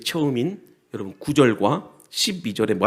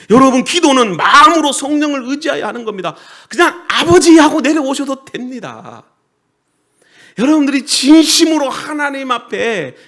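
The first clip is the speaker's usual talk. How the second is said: loud, raised-pitch pulpit delivery; forceful preaching; 12 semitones higher, 13 dB louder than usual. Real, not acted. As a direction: drive each point home loudly to the congregation. (힘주어 외치는 설교)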